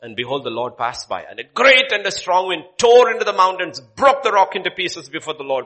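loud, ringing scripture reading; preaching at full force. Acted. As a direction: proclaim it to the whole hall. Reads God's command to Elijah.

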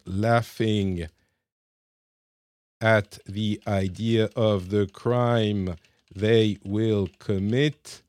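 slow, spelled-out English words as he types; dictating a prompt word by word; typing-along dictation. Real, not acted. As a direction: read each word out slowly while typing.

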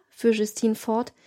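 ordinary delivery

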